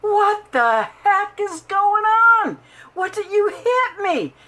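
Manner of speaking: a mock-shrill retold outburst; shocked outcry. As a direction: replay the moment you got hit. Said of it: "But I was like".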